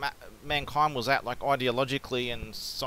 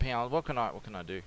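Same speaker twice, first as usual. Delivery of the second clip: ordinary talk, nothing marked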